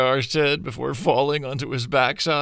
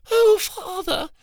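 hammed-up sobbing for pity; fake sob; 3 dB louder than usual. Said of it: "she faked a little sob".

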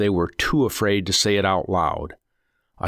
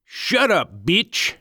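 laughing captor; 11 semitones higher, slower than usual. A narrator's reading. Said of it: "one captor laughed".

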